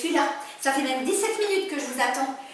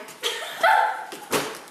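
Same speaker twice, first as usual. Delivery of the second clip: laugh. Acted. Laugh after argument.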